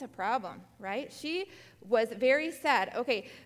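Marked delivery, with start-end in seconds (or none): none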